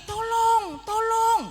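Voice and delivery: high, loud cry of tolong; a panicked ant screaming tolong; shouting for help. Acted. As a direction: yell for help from the sinking mud. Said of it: "lalu dia berteriak".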